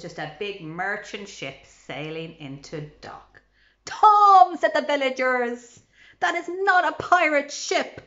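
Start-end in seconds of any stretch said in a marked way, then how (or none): 3.8-8.0 high, loud call to Tom; villagers calling out. Acted. Villagers calling to Tom, loud and high. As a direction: shout it across the village.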